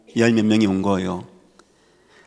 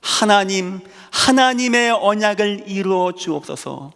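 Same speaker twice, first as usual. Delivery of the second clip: higher, fervent prayer to God; 8 semitones higher than usual. Retold or imitated. Joseph in prison praying to God, higher fervent. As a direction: cry out to God in faith. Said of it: "울고 막 애통해하고 하지 않았습니다".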